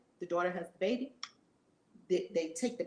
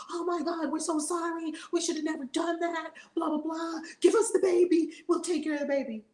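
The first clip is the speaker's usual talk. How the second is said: mock pleading; 7 semitones higher than usual. Parents apologize to the monk.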